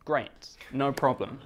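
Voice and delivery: ordinary talk, nothing marked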